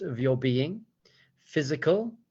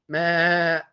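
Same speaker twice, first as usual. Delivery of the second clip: loud, higher-pitched sheep bleat; 4 semitones higher, 5 dB louder than usual. Acted. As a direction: bleat like a lion cub who thinks it's a sheep. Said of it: "the lion cub looks at him and he's like".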